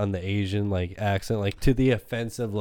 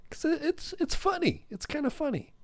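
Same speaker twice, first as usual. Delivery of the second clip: very high, soft sheepish squeak; tiny squeaky voice; 9 dB quieter than usual. Real, not acted. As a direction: admit it in a small falsetto, much softer.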